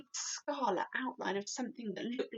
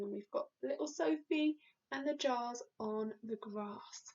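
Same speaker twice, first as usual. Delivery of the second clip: ordinary delivery, nothing marked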